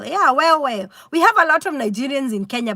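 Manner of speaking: normal talking voice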